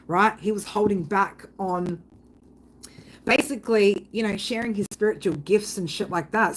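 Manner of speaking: normal talking voice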